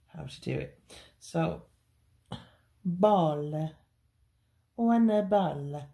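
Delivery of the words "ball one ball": mock Italian accent, hammed-up. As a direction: say it like a jokey Italian chef. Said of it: "My Italian accent".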